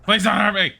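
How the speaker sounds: high, loud pleading; terrified horror victim. Pretend. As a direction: beg for mercy, squealing. Voicing scared cow.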